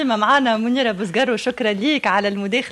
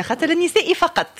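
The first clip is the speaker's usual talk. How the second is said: laughing; 7 semitones higher than usual. Real, not acted. Host jokes about a women-only prize.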